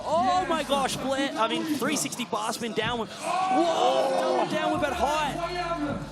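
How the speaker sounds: real thrilled commentary; excited shout. Real, not acted. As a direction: yell in disbelief at the trick. Commentator reacts to Logan's run.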